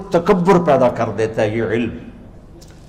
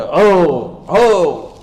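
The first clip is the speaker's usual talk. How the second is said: jubilant cry; 3 semitones higher, much slower than usual. Acted. Believer getting his paper in the right hand, jubilant cry. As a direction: let out a victory cry. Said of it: "ये खुशी का इजहार होता है".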